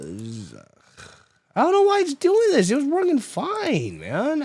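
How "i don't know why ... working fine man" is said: high, strained complaining whine; exasperated, near yelling. Real, not acted. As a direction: protest helplessly, voice pitched way up. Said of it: "I literally wanted to just start yelling".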